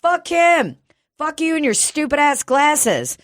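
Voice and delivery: high, loud mock cheer egging her on. Narrator cheering on woman cursing the greeter, high loud mock cheer. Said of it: "And I was like".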